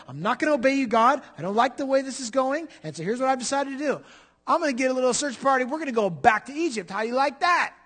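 high, loud, hammed-up defiance; fired-up, fast-building mock rebellion; defiant rebel. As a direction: act out a rebel talking back to God. Preacher acts out rebel defying God.

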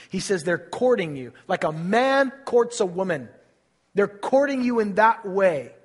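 loud, high fervent preaching; preaching at full force. Acted. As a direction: build the sermon to a loud climax.

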